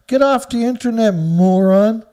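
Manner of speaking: loud, exasperated telling-off; angry scolding. Real, not acted. Ranting at whoever put nuclear on the internet, angry. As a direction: raise your voice on a cutting insult.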